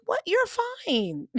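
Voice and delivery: dismissive surprise. Retold or imitated. Reassuring trainee, high surprised brush-off. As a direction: brush off his worry with a laugh. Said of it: "I'm like".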